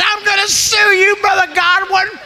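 high-pitched mock outrage; outraged woman. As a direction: jump up and threaten in a high voice. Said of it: "she jumped up and said".

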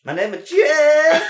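high, loud, very slow laughing line; mock dopey Jeff. Retelling a movie meme to friends, high slow laughing impression. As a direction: stretch it out dopey and amused. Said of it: "He's like".

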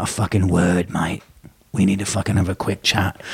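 low East End menace; calm, threatening Cockney word in the ear; lightly voiced London hard man; quiet gangster. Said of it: "talks to you like that".

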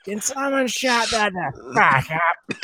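high, drawn-out Aussie holler; slow mock Aussie yell; Aussie accent yelling. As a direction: heckle in a broad Aussie accent. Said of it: "awesome Aussie accents".

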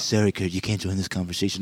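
imitating a soft voice, low and hushed; mock soft voice. Host repeating co-host's put-down, mock soft voice. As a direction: drop low and soft to mimic him. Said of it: "in that soft ass voice".

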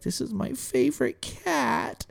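high, slow imitation of a doting cat lover; doting Elisa. Elisa holding and petting the cat, imitated doting. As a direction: draw it out in a high adoring voice.

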